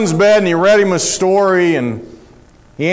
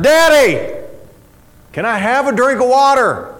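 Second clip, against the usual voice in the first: put-on child calling out; 5 semitones higher than usual. Son calling from bed again.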